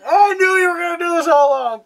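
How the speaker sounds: high, loud put-on villain; hammed-up chirpy phone taunt; mock cheery villain. Villain's phone call, played for laughs.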